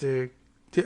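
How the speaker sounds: ordinary talk, nothing marked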